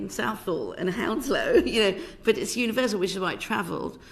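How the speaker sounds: ordinary delivery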